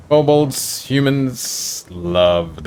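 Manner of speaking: lightly voiced halting old kobold; a hint of broken creature speech. Old kobold tells the party his words, light creature voice. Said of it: "the old kobold says".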